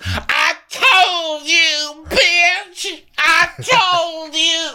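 very high, drawn-out wail of outrage; mock screech. Erica vindicated before the other wives, hammed-up screeching. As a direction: screech it slowly, stretching every word.

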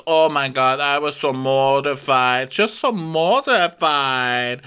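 put-on high, slow accented mortification; put-on accent, dramatic and drawn out; Diana. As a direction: drag out the words in mock horror. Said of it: "She goes".

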